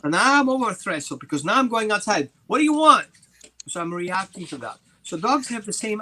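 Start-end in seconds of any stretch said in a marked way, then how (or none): 2.5-3.1 higher, louder demand at the gate; startled homeowner calling out at a trespasser; confronting shout. Retold or imitated. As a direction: challenge the stranger hard. Confronting a passer-by.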